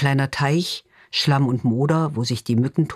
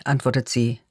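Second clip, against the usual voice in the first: ordinary delivery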